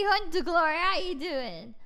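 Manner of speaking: usual speaking voice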